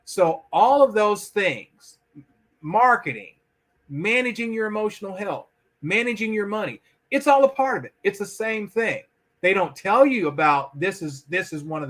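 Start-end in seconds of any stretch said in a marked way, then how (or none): none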